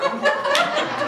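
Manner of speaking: loud, high burst of laughter; real delighted laugh. Real, not acted. Laughing at naming Sarah.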